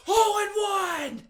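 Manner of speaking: high, loud, slow victory cry; triumphant shout. Retold or imitated. Podcaster voicing Kramer shouting his golf triumph. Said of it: "Kramer's just like".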